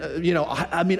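usual speaking voice

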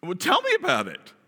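high, loud disbelieving question; incredulous disciples. Acted. Disciples asking the stranger in disbelief, loud and high. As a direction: act amazed he hasn't heard the news.

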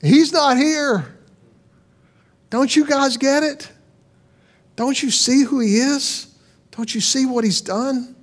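the stone announcing the empty tomb; lightly voiced stone, imploring; mock shout. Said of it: "the stone's way of shouting".

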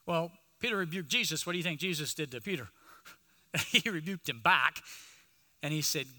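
ordinary talk

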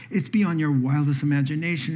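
ordinary delivery

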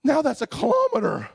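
high, half-crying complaint; exhausted near-sob; mock whine. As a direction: whimper it like the run was endless. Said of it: "And I'm like".

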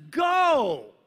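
high, loud "Go" with full command; booming divine command; commanding shout. Shown as a demonstration. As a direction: bark "Go" like God ordering. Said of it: "He just says".

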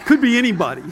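high, loud words through laughter; real laughter breaking into speech; laughing. Real, not acted. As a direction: crack up at your own slip. Amused at own accidental accent.

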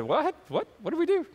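very high, squeaky questions; hammed-up bewilderment; startled disbelief. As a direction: blurt it out in high-pitched mock astonishment. Acted startled reply at the door.

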